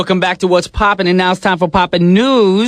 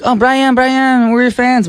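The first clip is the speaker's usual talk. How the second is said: excited fan voice; 9 semitones higher, slower than usual. Retold or imitated.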